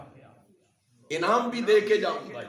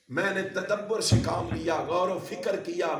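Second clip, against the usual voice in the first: usual speaking voice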